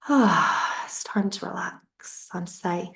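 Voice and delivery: ordinary talk, nothing marked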